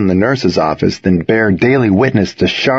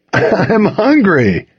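high, drawn-out excuse; apologetic; 10 semitones higher, much slower than usual. A narrator's reading. Boyfriend excusing his stolen fries, apologetic. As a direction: stretch it out as a raised-pitch plea. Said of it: "said by way of apology".

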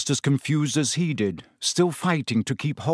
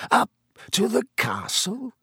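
silky; 10 semitones higher than usual. A narrator's reading. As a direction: silkily toy with a cornered enemy. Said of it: "said Snape silkily".

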